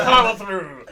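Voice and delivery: loud rallying shout; shouted cheer. Acted. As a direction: yell it like a battle cry. Player cheers NPC on.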